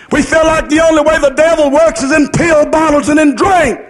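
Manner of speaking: ordinary talk, nothing marked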